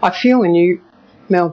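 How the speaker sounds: ordinary talk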